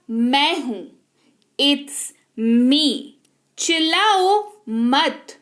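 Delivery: slow, careful repeat-after-me phrases, each phrase said twice; teacher modelling phrases clearly for students to copy; slow drill reading. Shown as a demonstration. The teacher reads each phrase slowly and clearly for learners to repeat.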